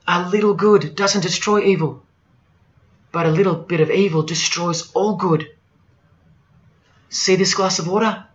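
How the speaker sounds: ordinary talk, nothing marked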